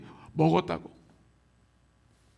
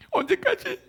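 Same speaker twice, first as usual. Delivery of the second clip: put-on high child asking how long; whiny child; 3 dB louder than usual. Child asking the waiting father, high whine. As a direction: squeak it like an impatient kid.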